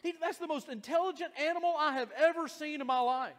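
high, loud, urgent pleading; alarmed shout. Retold or imitated. Butcher begs the angry man to stop, high shout.

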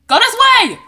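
loud, high command shout. Real, not acted. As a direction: yell directions. Fleeing mobs.

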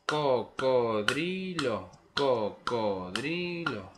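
cocodrilo drawn out very slowly in syllables; syllable rhythm. Shown as a demonstration. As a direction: clap cocodrilo slowly like jaws. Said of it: "Y hay que hacer así".

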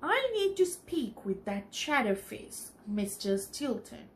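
plain reading voice

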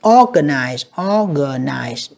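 "organize" with a strong OR, then falling; slow model of "organize", leaning on OR; first-syllable stress. Shown as a demonstration. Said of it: "âm một nha các bạn". ("organize" nhấn âm một, đọc chậm)

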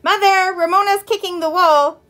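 high, loud call to mother; tattletale cry. A narrator's reading. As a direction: holler to mum, telling on your sister. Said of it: "cried Tattletale Beezus".

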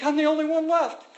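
high, loud whining; whiny Elijah. Retold or imitated. Preacher mocking Elijah's whine, high and loud.

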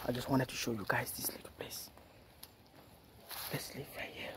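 hushed whisper so as not to wake the goddess; whisper, afraid to disturb anyone; frightened whisper at a holy place. Real, not acted. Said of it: "I don't want to disturb them".

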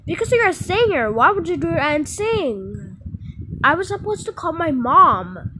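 high, loud accusing yell; angry yelling. Acted. Kid yells at her sister in a row. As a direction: shout it back hard.

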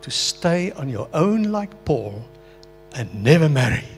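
a high, insistent voice of doubt; warning inner voice. Retold or imitated. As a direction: warn him off like a tempter. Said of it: "Then that voice came back again".

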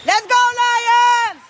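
high, loud, long drawn-out fan yell; cheering shout. Real, not acted. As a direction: holler it across the court, stretching the vowels. Cheering team at game. (grito de ánimo alargado)

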